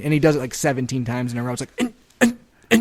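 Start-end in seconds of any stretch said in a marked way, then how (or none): 1.8-2.8 high squeaky stifled sneeze; imitated held-back sneeze. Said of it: "Brandon's sneeze sounds like".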